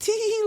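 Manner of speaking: high-pitched bashful tee-hee; mock-modest titter; coy giggle. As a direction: squeak out a tiny fake giggle. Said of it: "you're very, like".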